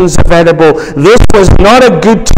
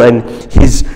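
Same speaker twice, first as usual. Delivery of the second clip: ordinary talk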